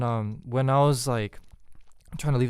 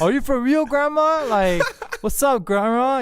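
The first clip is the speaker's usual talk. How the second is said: much higher, louder, laughing through it; playful disbelief; 11 semitones higher, 4 dB louder than usual. Retold or imitated. Translating his teasing line to grandma, high and laughing. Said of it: "That means, like".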